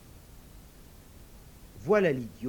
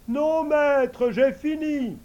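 much higher, louder eager servant voice; invisible servant; 12 semitones higher, 9 dB louder, much slower than usual. Acted. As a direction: answer the master high and ready. Servant answers.

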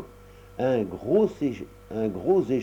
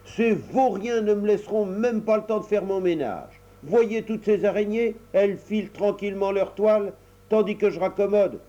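cross mother; 8 semitones higher than usual. Acted. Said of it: "Elle se fâcha de plus belle".

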